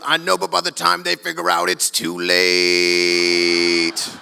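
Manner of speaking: sly brother. Retold or imitated. Brother's sly con line.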